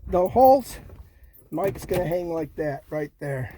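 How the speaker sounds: ordinary delivery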